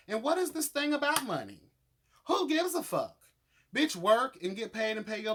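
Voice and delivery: indignant rant. Real, not acted. Vlogger ranting at a money-hungry cast member, high and loud. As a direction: throw the question out in disbelief.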